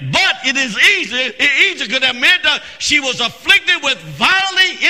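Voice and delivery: high, driving pulpit cadence; full-force preaching. Real, not acted. Preacher telling of the mother's only hope, fervent preaching. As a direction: preach with rising fervour.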